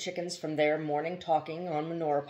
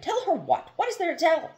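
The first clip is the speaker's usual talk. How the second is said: much higher, incredulous; scornful challenge; 13 semitones higher, 4 dB louder than usual. A narrator's reading. Joseph daring Burton to tell his wife, high and incredulous. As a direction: toss the threat back at him.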